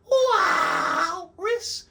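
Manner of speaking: drawn-out high whooping holler; signature name call. Shown as a demonstration. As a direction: whoop the nickname, stretching the first syllable. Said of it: "a way I said his name".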